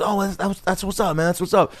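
high-pitched retold celebrity voice; high, easygoing impression of a rapper. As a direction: imitate him, pitched well up. Acts out [name]'s reply.

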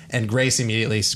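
normal talking voice

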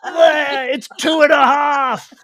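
high, loud laughing scoff; mock outraged Italian. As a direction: laugh while shouting like a picky Italian cook. Mocking fussy Italian villager.

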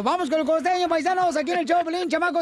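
host hyping the next act; radio announcer. Acted. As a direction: introduce the comedian with gusto. Host introduces comedian.

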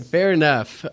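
ordinary talk

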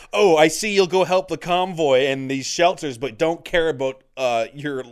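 the angry customer denied a sandwich, mock indignant. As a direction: imitate an indignant customer huffing. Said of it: "woman got so mad".

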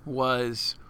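ordinary talk

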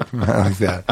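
ordinary talk, nothing marked

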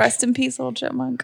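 quiet mock eulogy; hushed farewell. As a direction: bid the chipmunk a soft goodbye.

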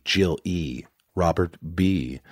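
normal talking voice

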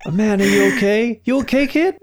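high, put-on caring voice; concerned passerby. Passerby asking the kid if he is okay. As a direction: play the helpful stranger. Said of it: "this guy just comes and he goes".

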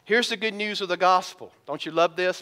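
ordinary talk, nothing marked

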